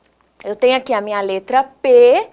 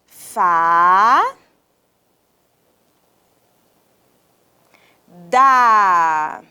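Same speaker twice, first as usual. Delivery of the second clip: very long rising "Fá", long pause, falling "da"; dictation-slow "Fá, da"; drawn-out syllables; 5 semitones lower, 4 dB louder than usual. Shown as a demonstration. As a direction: stretch "Fá, da" as if writing along. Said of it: "Vamos escrever?".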